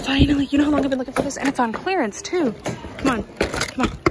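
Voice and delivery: loud, thrilled disbelief; loud, elated rush; excited outburst. Real, not acted. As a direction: burst out with long-awaited triumph. Finds long-sought item on clearance.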